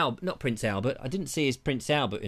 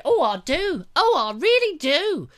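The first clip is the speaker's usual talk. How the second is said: mock bride; 12 semitones higher, 5 dB louder than usual. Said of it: "She said".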